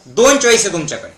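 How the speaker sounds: brisk, matter-of-fact delivery; quick statement. Shown as a demonstration. First states the key point. (भरभर, साधेपणाने)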